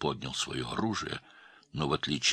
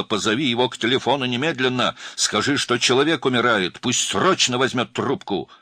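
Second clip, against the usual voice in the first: higher, louder frantic demand; urgent shout; 7 semitones higher, 6 dB louder than usual. A narrator's reading. Girl shouting into phone for urgent help. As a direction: cry out as if a life depends on it. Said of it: "она закричала".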